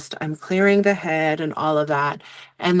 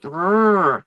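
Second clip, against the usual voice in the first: short growled "rrr"; rolling throat noise for discomfort; imitated grinding "rrr". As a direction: voice an uncomfortable sensation as a growl. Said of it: "It's just like".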